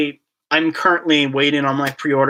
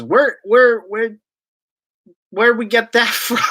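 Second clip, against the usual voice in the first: much higher, laughing in disbelief; 8 semitones higher, 3 dB louder than usual. Real, not acted. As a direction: laugh at the strange topic. Baffled by the chat topic.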